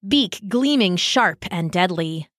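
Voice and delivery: ordinary delivery, nothing marked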